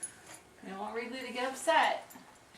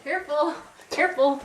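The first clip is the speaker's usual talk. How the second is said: sharp warning; 5 semitones higher, 9 dB louder than usual. Real, not acted. Warning toddler near dog.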